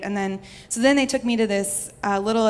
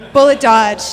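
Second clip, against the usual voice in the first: a loud, proud "bullet dodge"; triumphant shout; 3 semitones higher, 13 dB louder than usual. Real, not acted. Telling the audience about dodging mom's anger, a triumphant shout. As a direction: shout it like you just escaped.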